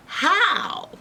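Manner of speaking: put-on high-pitched girlish question; puzzled Goldilocks. Girl asking the bear about respect, high puzzlement. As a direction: ask it high and wondering. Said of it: "And Goldilocks is like".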